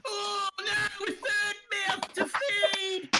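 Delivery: high, whiny Cockney rat in a flap; squeaky Cockney rat voice; panicky Cockney rat. Acted. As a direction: wail like a fretting street rat. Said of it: "turns at you and says".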